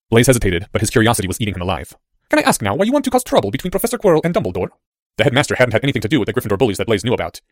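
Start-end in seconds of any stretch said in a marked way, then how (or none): 2.3-4.7 put-on higher-pitched boy's questioning; curious boy. A narrator's reading. Boy questions his cloaked handler, higher and curious. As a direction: ask in a raised, youthful voice.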